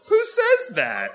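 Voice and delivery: high, drawn-out incredulous squeal; laughing disbelief. Real, not acted. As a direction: squeal it slowly through laughter. Incredulous at woman's bloodline question.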